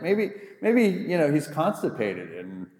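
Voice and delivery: high-pitched teasing jeer, acting out Elijah; mocking taunt. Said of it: "That's what Elijah is saying".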